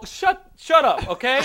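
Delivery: irate shout at the movie audience; angry shout. Retold or imitated. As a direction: snap at the crowd to shut up. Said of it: "I was so irate in my dream".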